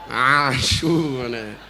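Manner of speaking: Asyura said slow and drawn out the Javanese way; Javanese pronunciation. Shown as a demonstration. Preacher showing how Javanese say Asyura, slow drawn-out word. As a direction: stretch out Asyura slowly. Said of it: "Orang Jawa ngomong Asyura itu susah".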